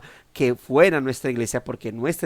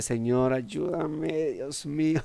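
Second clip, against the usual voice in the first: soft, pleading aside to God; quietly begging God for patience; hushed prayer; 6 dB quieter, much slower than usual. Retold or imitated. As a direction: pray it under your breath, exasperated. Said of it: "y le decía".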